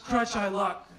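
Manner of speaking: ordinary talk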